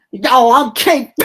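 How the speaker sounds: slow, drawn-out mock street swagger; wannabe street rapper. Host mocking the rapper's second verse, wannabe street voice. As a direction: imitate a rapper straining to sound street. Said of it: "like he's trying to sound street".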